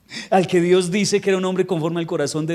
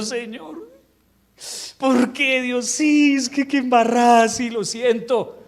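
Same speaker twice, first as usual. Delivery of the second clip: higher, pleading prayer that slows at the end; mock lament; 7 semitones higher, slower than usual. Preacher voicing David praying over his sick son, higher pleading lament. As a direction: cry out to God like a sorry king.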